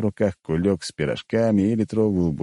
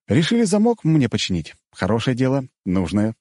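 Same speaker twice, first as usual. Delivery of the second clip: smiling irony; 5 semitones higher than usual. Acted. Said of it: "улыбнулся я".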